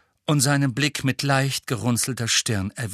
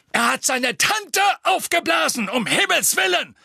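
higher, louder outraged shout; 3 dB louder than usual. A narrator's reading. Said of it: "rief Fudge".

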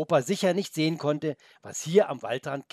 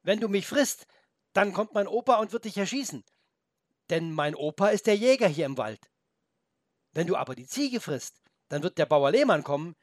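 put-on higher, eager young boy; boy's voice; 6 semitones higher, 3 dB louder than usual. The boy warns the wolf about his grandpa, high and eager. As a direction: press the point like a brave boy.

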